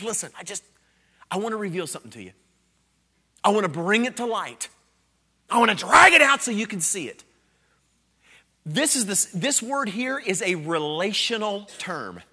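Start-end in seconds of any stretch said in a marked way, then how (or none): 0.0-7.2 Jesus revealing, building. Retold or imitated. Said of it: "Jesus comes and says".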